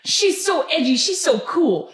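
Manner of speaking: high gushing fangirl voice; mock gushing. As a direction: gush over it, high-pitched. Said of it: "you would be like".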